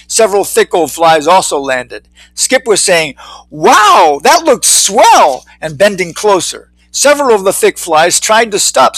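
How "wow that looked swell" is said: high, slow, drawn-out wonder; wowed. Acted. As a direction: marvel at it, stretching each word. Skip marvels at the hole.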